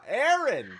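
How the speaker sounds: high, loud call across the room; calling out a name. Real, not acted. Host calling out to a friend who left, loudly. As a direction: shout her name to get her attention.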